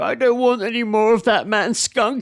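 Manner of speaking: high, slow put-on voice of a guy at the toilet; comic impression of a man muttering to himself; quoted drunk mate. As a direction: ham it up, slow and high, like a mumbling stranger. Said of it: "we just hear him go".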